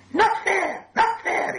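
very high, loud creature whine; upset, high-pitched hissing creature; hissed protest. A narrator's reading. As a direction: hiss and squeal in outrage like a cave creature. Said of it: "he hissed".

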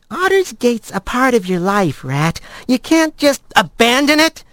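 cheeky friend; Blaze voice. A narrator's reading. Blaze character voice.

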